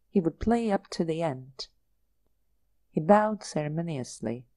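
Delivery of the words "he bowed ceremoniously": ordinary delivery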